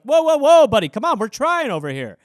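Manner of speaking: startled high, loud protest easing to a reasoning tone; panicked cry of whoa that settles into pleading; pleading computer. Pretend. Computer to angry owner, mock-alarmed pleading. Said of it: "Expecting my computer to be like".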